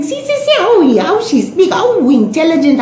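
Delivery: high, gushing mock admiration; admiring grandmother. Grandmother showing off the child's speech, gushing admiration. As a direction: marvel in a high excited auntie voice. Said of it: "Ayo, and she's saying".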